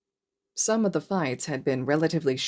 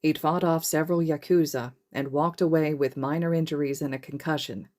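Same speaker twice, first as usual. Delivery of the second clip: usual speaking voice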